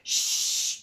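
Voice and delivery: "Chut" breathed out with no voice, just the ch hiss; whispered shh. Shown as a demonstration. Teacher whispers "Chut" to show the child the ch sound. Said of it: "c'est comme le chat".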